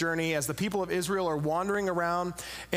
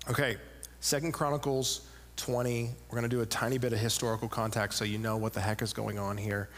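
normal talking voice

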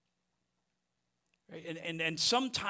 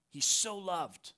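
ordinary talk

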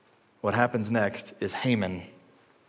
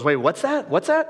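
startled question; 8 semitones higher than usual. Retold or imitated. King suddenly alarmed by news.

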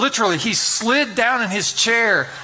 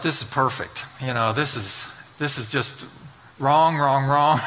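sarcastic inner thought; 6 semitones lower, slower than usual. Retold or imitated. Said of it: "And I just thought".

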